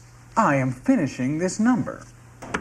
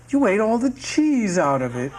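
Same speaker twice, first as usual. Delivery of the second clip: indignant disbelief; 7 semitones higher than usual. Acted. Cheated of the cheese.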